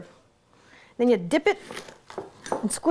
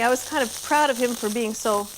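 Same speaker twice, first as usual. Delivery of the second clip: ordinary talk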